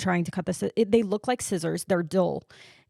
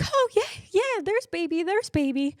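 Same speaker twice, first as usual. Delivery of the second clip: much higher, cooing encouragement; imitated cheering nurses; 10 semitones higher than usual. Nurses greeting a newborn's first cries, imitated high cooing. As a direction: coo in a high-pitched voice. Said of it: "the nurses are like".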